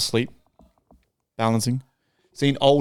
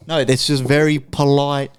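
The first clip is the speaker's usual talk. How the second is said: ordinary talk, nothing marked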